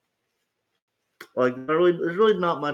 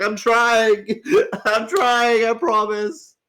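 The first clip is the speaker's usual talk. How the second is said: louder, higher, laughing through it; laughing plea; 5 semitones higher, 5 dB louder, slower than usual. Retold or imitated. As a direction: beg with a laugh. Retells pleading to stop cussing.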